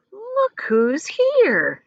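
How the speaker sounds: a strange creature greeting from the dark; eerie unseen voice. A narrator's reading. Voice in the peach pit greeting James, high and slow. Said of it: "he heard a voice say".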